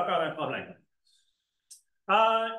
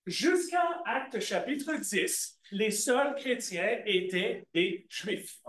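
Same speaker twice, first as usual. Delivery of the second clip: slow emphatic; slower than usual. Real, not acted. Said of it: "teacher slowing for emphasis".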